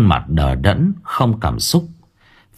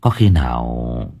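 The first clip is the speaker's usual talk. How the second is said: worried thought; 8 semitones lower than usual. A narrator's reading. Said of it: "nghĩ tới một điều tồi tệ".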